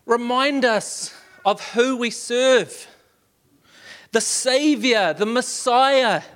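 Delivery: high, rising worship prayer; impassioned worship-leader prayer; fervent prayer. Acted. Leads congregation in prayer.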